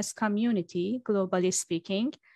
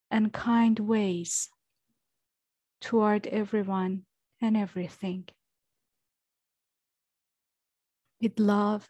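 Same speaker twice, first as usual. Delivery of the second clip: guided meditation. Acted. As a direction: guide them gently into stillness, unhurried. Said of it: "let's have a centering meditation and intention together".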